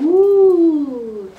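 loud, high ooh of wonder; be amazed at what you hear; excited ooh. Pretend. Librarian gasping at the bag's clues, excited.

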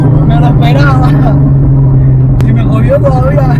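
excited shout. Real, not acted. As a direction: shout it out, blown away. Passenger reacting mid ride.

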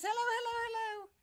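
very high-pitched kitty voice; quick high repeated cat hellos; talking cat. Acted. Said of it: "Kitty Cat says".

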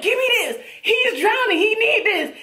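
high-pitched, frantic retelling; retold urgent demand. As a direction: demand it as if someone is drowning.

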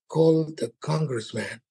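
slow, deliberate replay of "Call the congressman" with each word drawn out; slow repeat. Retold or imitated. Comedian replays the news clip in disbelief, slow and deliberate. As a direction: repeat it slowly as if rewinding the tape. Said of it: "let me rewind this".

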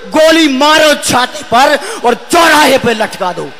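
slow, ringing, hard-hit verse; fiery poem declamation. Acted. Poet demanding punishment for rapists, fiery declamation. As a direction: thunder each line out as a demand.

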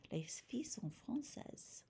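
whispered repeat of "Les filles sont françaises"; soft whispered echo for the class. Shown as a demonstration. Teacher repeats sentence whispered.

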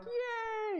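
put-on very high delighted baby squeal; happy baby. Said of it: "then he's like".

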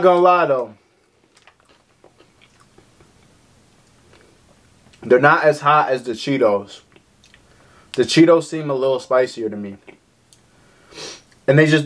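talking between bites; chewing while talking. Real, not acted. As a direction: mouth partly full. Seen 0: Lips working and licking after eating a chip, mouth busy throughout.

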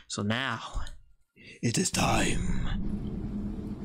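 slow breathy ominous whisper; dramatic whisper. Pretend. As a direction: draw it out like a movie villain. Dramatic build-up before final plan.

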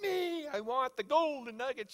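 high, drawn-out put-on voice; mock eager seeker. Preacher teasing Bible nugget hunters, mock eager. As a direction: stretch it out like a caricatured treasure hunter.

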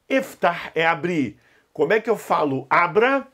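ordinary talk, nothing marked

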